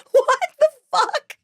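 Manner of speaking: high, laughing, slowed words of disbelief; cracking up at the absurdity. Real, not acted. Telling her friend about the IV bag shortage, laughing in disbelief.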